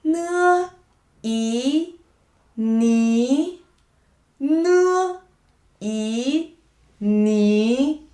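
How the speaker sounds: "Nơ" high and level, "ý" and "ní" rising; nasal N. Shown as a demonstration. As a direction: spell out "Nơ, ý, ní" with nasal n and climbing final. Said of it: "Focus on the nasal cavity".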